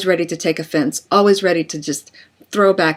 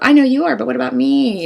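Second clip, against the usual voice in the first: higher, bratty playground taunt; childish seventh grader; 6 semitones higher, 4 dB louder, faster than usual. Acted. Defensive spouse answers like a kid, bratty higher taunt. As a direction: sound like a sulky seventh grader. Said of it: "that seventh grader quality".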